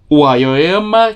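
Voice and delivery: slow, hesitant try at saying "Aoyama"; unsure name pronunciation. Real, not acted. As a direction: sound out "Aoyama" carefully, unsure how it goes. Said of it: "que eu não lembro se pronuncia assim".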